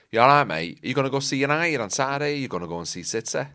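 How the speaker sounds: Mancunian lad chatting; Manchester accent. Shown as a demonstration. Asking a mate about Saturday's football, Manchester accent. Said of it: "do a really good Manchester accent".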